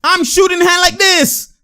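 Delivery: high, slow cry of complaint; Paul George. Acted. Commentator voices a complaining player, high mock-outraged cry. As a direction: wail it like a mock protesting player. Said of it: "You can see they're complaining".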